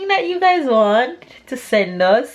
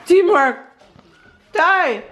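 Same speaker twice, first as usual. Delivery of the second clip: excited; 7 semitones higher, 4 dB louder, slower than usual. Real, not acted. Cheering the finished crepes.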